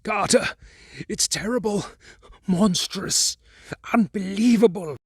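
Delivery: high, frantic cry of horror; a man gasping out what he sees below; frenzied terror. Acted. Warren calling up from the vault, frenzied with fear. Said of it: "Then came the frenzied tones again".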